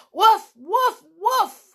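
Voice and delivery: high, loud barks; Timmy barking loud to be heard; dog barking. Acted. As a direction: bark like an eager dog. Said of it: "Timmy began to bark deafeningly".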